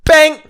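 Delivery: loud high bang for a punch; punch sound. Retold or imitated. Second guy gets hit, a loud high bang. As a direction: fire it off like a fist to the jaw.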